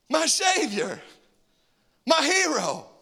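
high, loud starstruck gratitude; awed rescued cry. Pretend. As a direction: gaze up at your rescuer in awe. Saved person's awed reply.

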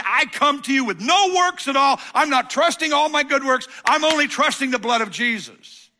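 high, loud declaration of faith; loud humble prayer. Pretend. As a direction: cry out your surrender. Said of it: "if you say".

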